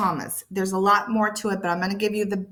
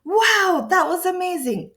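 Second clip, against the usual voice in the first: high, amazed exclamation; excited; 11 semitones higher, 3 dB louder than usual. Shown as a demonstration. Teacher giving learners an example of excitement, excited and amazed. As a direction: exclaim it in high-pitched delight. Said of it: "things of excitement".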